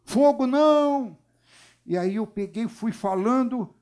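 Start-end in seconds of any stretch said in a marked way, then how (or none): 0.1-1.2 high, drawn-out cry; stretched, high-pitched yell of a demon; possessed woman's scream. Retold or imitated. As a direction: imitate her loud high shriek. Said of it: "a mulher gritava".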